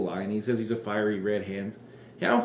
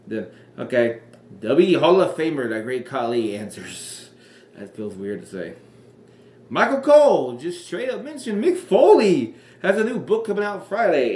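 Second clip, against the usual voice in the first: ordinary talk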